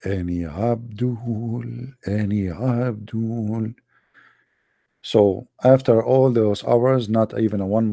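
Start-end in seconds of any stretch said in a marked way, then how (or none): 0.0-1.6 slow drawn-out call. Real, not acted. Calling out again, drawn out. 2.1-3.7 slow drawn-out call. Real, not acted. Calling out again, drawn out.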